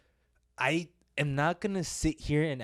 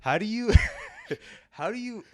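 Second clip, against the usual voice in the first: laughing; 14 semitones higher, 6 dB louder than usual. Real, not acted. Cracking up at the accent.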